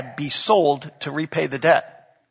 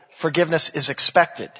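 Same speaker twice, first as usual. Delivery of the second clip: usual speaking voice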